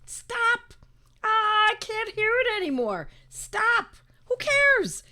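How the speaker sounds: high-pitched, fed-up outburst; exasperated. Real, not acted. Speaker tired of hearing cootie worries, exasperated. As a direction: cut off the complaints in high exasperation.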